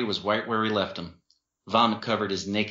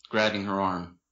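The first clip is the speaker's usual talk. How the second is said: usual speaking voice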